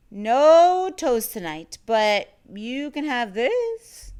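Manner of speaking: loud, slow, firm refusal; firm no to the monster. A narrator's reading. Child refusing the monster's toe snack, loud and firm. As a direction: block him off loudly.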